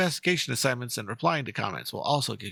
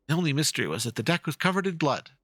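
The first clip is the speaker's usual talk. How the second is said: ordinary delivery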